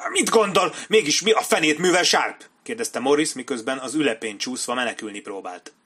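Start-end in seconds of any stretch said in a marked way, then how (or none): 0.1-2.4 high, loud, panicky outrage; alarmed protest. Acted. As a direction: cry out indignantly while under attack. Beaten captain protests, trying to escape. (rémült, felháborodott kiáltás)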